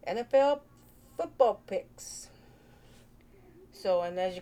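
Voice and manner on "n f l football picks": announcer intro. Acted. Vlogger opening her picks show, announcer style.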